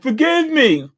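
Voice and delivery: high, frightened plea; cowering mock plea for mercy; scared begging. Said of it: "This dude is so scared".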